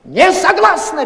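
high, loud, slow-bitten refusal; mother snaps back, refusing outright; shrill, loud no; sharp refusal. Acted. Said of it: "резко сказала мать".

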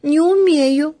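put-on little girl voice. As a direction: answer like a small shy girl. Голос Феньки. (тоненький голосок девочки)

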